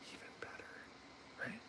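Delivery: breathy whispered aside. Real, not acted. Vlogger whispering a secret to viewers, hushed.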